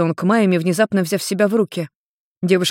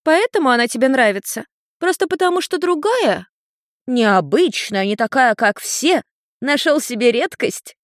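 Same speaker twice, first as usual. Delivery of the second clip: put-on high, singled-out teasing words in a child voice; mocking little girl; 7 semitones higher than usual. A narrator's reading. Little girl teases Ian about liking Maime, high mockery.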